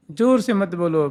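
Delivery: usual speaking voice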